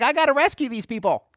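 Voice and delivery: high, loud exclamation as God; hammed-up urgent resolve; God to the rescue. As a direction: play an exasperated God deciding to step in. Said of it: "So God's like".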